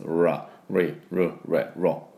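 ラ、リ、ル、レ、ロ from the back of the throat; tongue drawn back, each of ラ、リ、ル、レ、ロ a separate English R; throaty English R. Shown as a demonstration. Teacher drilling sound from deep in the throat, English R. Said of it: "喉の奥の方で音を出す".

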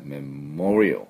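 slow English メモリアル; English pronunciation. Shown as a demonstration. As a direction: break メモリアル after "mem", then roll into a dark l. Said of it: "二音節".

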